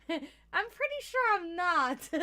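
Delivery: high, loud, drawn-out mock certainty; amused denial. Worker denying she's a bit stupid, amused denial. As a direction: stretch it out, on the edge of laughter.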